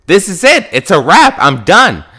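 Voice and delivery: loud, high and final, slamming the point shut; heated declaration. Retold or imitated. Defending her favorite pizza to Chicago locals, loud and high. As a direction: declare it done with rising heat.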